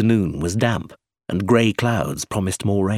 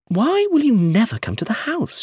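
higher, insistent question; demanding; 13 semitones higher than usual. A narrator's reading. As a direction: press him for an answer. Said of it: "she demanded".

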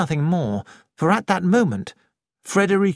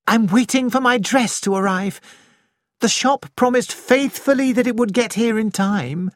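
Nick voiced higher, lively young woman; a lightly raised, lively young woman's voice; 8 semitones higher than usual. A narrator's reading. Nick voiced in a novel reading.

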